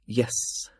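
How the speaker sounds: a short, worn-out yes; exasperated. Acted. The fool admitting his name to Jekk, exasperated. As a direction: give in with weary annoyance. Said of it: "he sounded exasperated".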